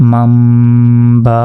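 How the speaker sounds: "মাম" held on a long nasal m before "বা"; with ghunna. Shown as a demonstration. Teacher joining the syllables, with ghunna. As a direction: hum the m of "মাম", then release "বা". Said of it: "গুন্নার সাথে".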